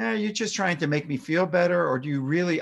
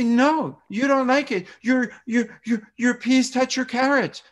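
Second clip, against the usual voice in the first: put-on much higher, louder, echoing a toddler's upset; toddlerese; 10 semitones higher, 5 dB louder than usual. Shown as a demonstration. Parent mirroring an upset toddler, high and loud toddlerese. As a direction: mirror the child's protest in baby-simple phrases. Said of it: "almost primitive or immature way of speaking".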